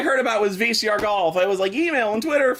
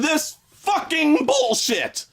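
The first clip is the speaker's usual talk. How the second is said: slow angry shout; 6 semitones higher, slower than usual. Real, not acted. Ranting at the game, slow angry shout. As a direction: drag out the curse, yelling.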